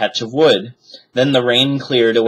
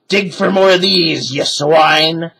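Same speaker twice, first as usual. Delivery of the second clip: gruff pirate order; 7 semitones higher, 4 dB louder, slower than usual. A narrator's reading. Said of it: "he said".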